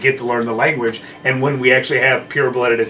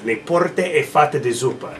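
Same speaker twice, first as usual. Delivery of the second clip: measured, slow Italian accent; convincing Italian; 2 semitones higher than usual. Shown as a demonstration. Gondolier teaching a fake Italian line, slow convincing Italian accent. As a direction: say it slowly, like genuine Italian. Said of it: "gotta be really convincing about it".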